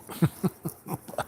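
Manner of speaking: breathy, high little chuckle; light laugh between quoted lines; acted chuckle. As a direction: chuckle as the amused priest. Retelling the priest's amused reply.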